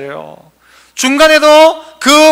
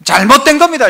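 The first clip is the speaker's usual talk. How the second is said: normal talking voice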